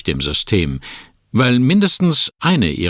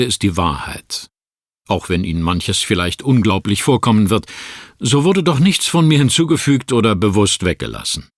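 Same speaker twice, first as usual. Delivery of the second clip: ordinary delivery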